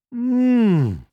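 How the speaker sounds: long high "mmm" of delight; loud, rising-pitched yummy hum; savouring "mmm". A narrator's reading. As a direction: hum with pleasure at a delicious smell. Savouring a delicious baking smell.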